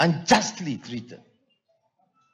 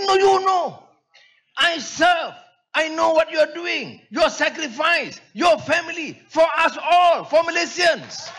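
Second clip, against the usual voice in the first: imitating a heated rebuke, much higher; mock angry lawyer; 9 semitones higher than usual. Karpal lecturing the speaker on his sacrifice, hammed-up heated. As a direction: voice the lawyer's scolding, pitched up, ending in a shout. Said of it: "Jadi dia marah".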